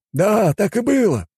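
high, drawn-out exclamation; eager exclamation. A narrator's reading. Homeless witness backs the driver, high exclamation. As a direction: pipe up slowly at high pitch. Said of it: "воскликнул бездомный".

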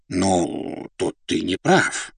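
потише, неторопливо возражая; возрази слуге осторожно; мягкое возражение. A narrator's reading. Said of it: "возразил князь". (мягко возражая)

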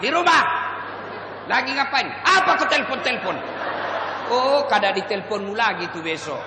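put-on a girl telling off a pushy caller; annoyed snap. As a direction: cut him off sharply.

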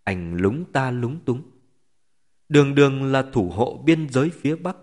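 usual speaking voice

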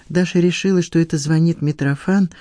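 ordinary delivery, nothing marked

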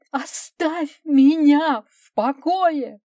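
loud, high, drawn-out desperate cry; shouted. A narrator's reading. Wife shuts out her husband at the door, shouting. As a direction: cry it out slowly at full voice. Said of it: "крикнула жена".

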